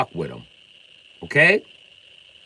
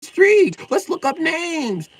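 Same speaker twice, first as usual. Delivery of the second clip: much higher, slow, gushing mock outrage; 7 dB louder, slower than usual.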